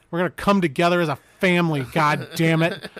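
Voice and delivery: a fed-up dad snapping at the dinner table; exasperated dad barking at the family; angry dad. Acted. Mock angry dad rant.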